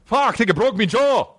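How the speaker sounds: high mock Irish accent, pained. Imitating the injured guy from the clip in a high mock Irish accent. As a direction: wail it in a mock Irish brogue. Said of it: "he's so Irish".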